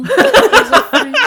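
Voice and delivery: high laughing, slow; giggling through the words. Real, not acted. Joining in, laughing.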